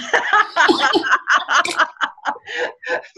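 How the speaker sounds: high burst of laughter; big bright laugh. Real, not acted. Mother laughing at her softball-game tantrums.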